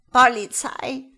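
put-on loud, high old-woman voice; frail old woman's counter-question. A narrator's reading. Old woman at the intercom questioning the police, loud and croaky. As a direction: throw it back loudly as a question. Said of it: "kam die Gegenfrage".

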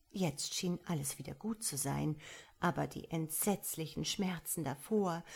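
ordinary delivery

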